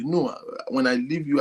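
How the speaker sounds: ordinary talk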